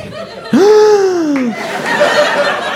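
long, high gasp of mock panic; panicked gasp. Comic realizing who is calling, panicked gasp. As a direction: suck in air in hammed-up alarm. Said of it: "Now I'm panicked".

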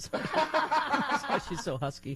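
laughing while talking. Real, not acted. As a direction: say it through laughter. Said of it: "Bella has a cold".

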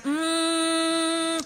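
a short hummed note as if finding a pitch; humming a tune. Retold or imitated. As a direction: hum one searching note. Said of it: "le hacían".

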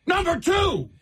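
very high-pitched mock yell; Stephen A. Smith. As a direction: holler it like an over-the-top sportscaster. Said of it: "screaming and yelling".

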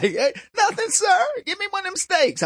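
high, hammed-up barbecue banter; chummy partygoer. Answering neighbours at the imagined barbecue.

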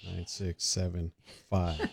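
low, soft radio DJ voice; smooth late-night host read. Shown as a demonstration. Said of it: "my night radio voice".

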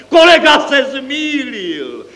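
very high, drawn-out crowing; triumphant accountant, stretching every word; jubilant. A narrator's reading. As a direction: rejoice in long, high-pitched cries. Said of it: "jásal účetní".

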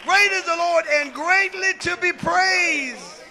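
high, loud, building sermon chant; full-force preaching. Real, not acted. Bishop building praise to the congregation, full-force preaching.

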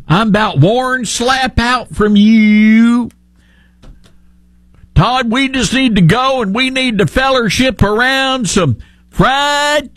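high, slow hammed-up Southern drawl; fake southern accent. Host mocking Kennedy's folksy act, slow exaggerated Southern drawl. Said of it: "this guy has a fake accent".

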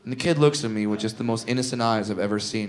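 ordinary talk

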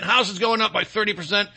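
ordinary delivery

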